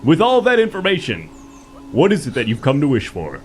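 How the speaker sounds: normal talking voice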